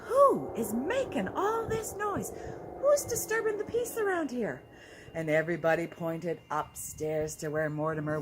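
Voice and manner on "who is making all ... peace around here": high, stern demand from the officers; hammed-up police officers. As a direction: play the officers asking who is making noise, pitched high. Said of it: "and they said".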